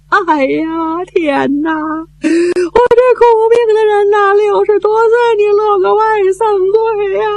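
put-on a touch of a weeping old woman's lament; mild mock wail. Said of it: "哇的一声就哭出来了". (老太太哭天抢地，略带哭腔)

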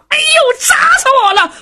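high, loud hammed-up yell of pain; a fake howl of pain, high and loud. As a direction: cry out in mock agony so everyone hears. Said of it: "高声喊嚷".

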